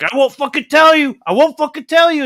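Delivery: high, loud stubborn refusal shouted twice; defiant yell. Acted. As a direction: yell it through gritted teeth at the demon. Priest refusing demon, acted quote.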